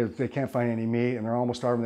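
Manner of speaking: normal talking voice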